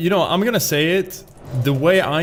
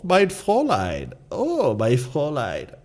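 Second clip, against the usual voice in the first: mock German accent; 5 semitones higher than usual. Streamer teasing his girlfriend during the movie, German accent. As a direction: tease her with a hammy German accent. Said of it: "I'm gonna go".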